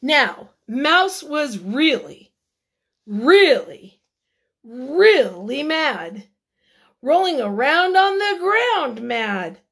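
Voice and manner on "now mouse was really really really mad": louder, drawn-out really mad; stressed really. A narrator's reading. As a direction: lean hard into each really. Building up Mouse's anger.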